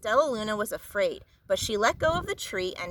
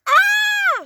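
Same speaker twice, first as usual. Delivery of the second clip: very high, loud terrified shriek; 10 dB louder than usual. A narrator's reading. Flap shrieking while falling in the dark. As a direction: scream in sheer panic. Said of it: "shrieked Flap".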